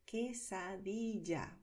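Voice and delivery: quesadilla said slowly and carefully. Shown as a demonstration. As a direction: model quesadilla for learners. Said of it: "cómo decir".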